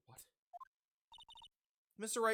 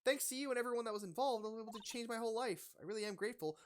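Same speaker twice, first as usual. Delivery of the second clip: higher, earnest woman's voice; voiced Adrian; 8 semitones higher, 4 dB louder than usual. Adrian thanking Nick, higher earnest voice. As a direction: pitch up, sincere thanks.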